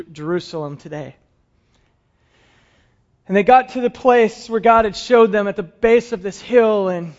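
usual speaking voice

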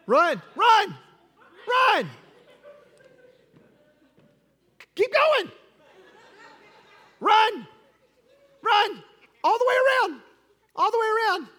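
high, loud, laughing calls to keep running; excited calling out. Real, not acted. Pastor cheering a running child on, high excited calls. Urging a child to run around.

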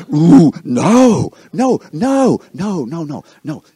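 loud and high at first, fading down; panicked denial. Acted. Peter denying he knew Jesus, panicked then fading. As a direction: deny it in a rush, then trail away.

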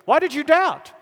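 high, loud, elated disbelief; excited encouragement. Acted. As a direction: ask it as a thrilled cheer, pitched right up. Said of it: "hard to capture the emotion".

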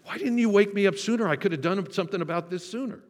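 high, fast, flustered waking; startled panic. Pretend. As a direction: blurt it out in a startled rush. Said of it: "come to his feet with a gasp and say".

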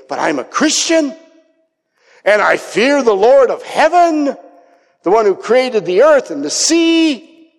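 high, loud religious proclamation; pious protest. Acted. Believer protesting their faith like Jonah, high and emphatic. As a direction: insist grandly, pitch lifted well up. Mimicking Christians' pious self-declaration.

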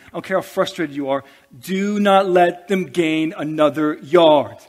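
coach's rallying shout. Retold or imitated. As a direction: bellow it like a coach in the huddle. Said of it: "he's saying".

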